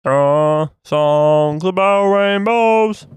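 very slow, high Kermit try. Pretend. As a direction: warble it like the frog. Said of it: "trying to learn how to do Kermit".

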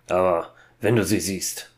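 ordinary delivery, nothing marked

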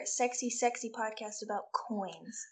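femme fatale whisper; sultry voice. Pretend. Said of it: "but in a sultry voice".

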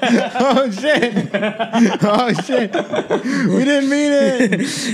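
high, helpless laughter through the words; real laughing fit. Real, not acted. Podcaster losing it at a joke, laughing hard. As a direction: crack up, stretching the words out.

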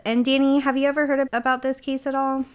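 usual speaking voice

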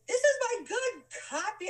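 high, loud indignant splutter; spluttering protest. Retold or imitated. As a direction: sputter it out in mock outrage. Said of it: "he's spluttering".

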